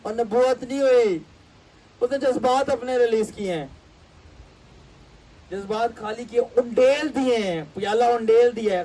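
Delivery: sarcastic emphasis. Retold or imitated. Sarcastic exposure of false prophecy.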